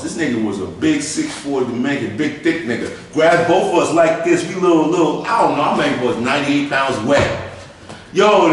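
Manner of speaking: ordinary talk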